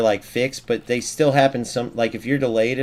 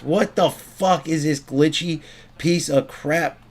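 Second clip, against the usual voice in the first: higher, slow, drawn-out exasperation; exasperated; 4 semitones higher, slower than usual. Real, not acted. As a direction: space out each word in real frustration. Raging at glitchy game.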